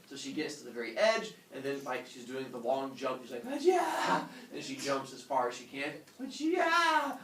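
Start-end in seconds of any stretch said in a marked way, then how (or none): 3.5-4.2 high, loud jumper's yell; leaping cry. Retold or imitated. Runner jumps for the island, high loud cry. Imitates the long jump cry. 6.2-7.2 high, loud jumper's yell; leaping cry. Retold or imitated. Runner jumps for the island, high loud cry. Imitates the long jump cry.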